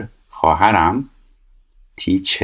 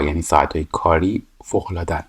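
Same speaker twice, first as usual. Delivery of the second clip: ordinary talk, nothing marked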